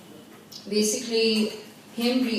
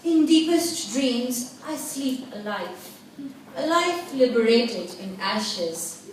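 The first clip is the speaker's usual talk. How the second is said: poetry recitation; slower than usual. Acted. Poet performing own verse to an audience, intense slow recitation. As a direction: lift each opening phrase, then let it sink into a pause.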